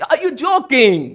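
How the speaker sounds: lightly voiced surprised girl; girl's incredulous question. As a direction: stretch it out like she can't believe it. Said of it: "उसने बोली".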